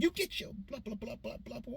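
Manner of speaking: mother snapping. Retold or imitated. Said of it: "my mother snapped me back".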